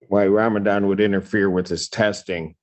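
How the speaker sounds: ordinary delivery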